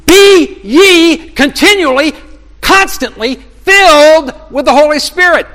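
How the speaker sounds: high, loud and slow, like a preacher's command; urgent proclaiming shout. Acted. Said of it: "Paul is shouting to us with the urgency".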